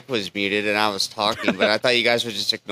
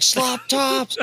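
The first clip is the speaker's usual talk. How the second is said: high-pitched imitation of a yell; retold yell; 14 semitones higher than usual. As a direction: yell it out in a much higher voice, imitating him. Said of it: "he yelled".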